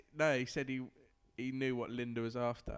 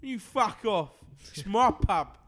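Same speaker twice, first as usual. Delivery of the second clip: higher, louder barking "Oi!" and snarled insult; aggressive pub lout; 7 semitones higher, 9 dB louder than usual. Acted. Drunk lout in the pub picking on a stranger, loud barking snarl. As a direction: bellow it like a drunk spoiling for a fight. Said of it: "you gotta have the proper voice".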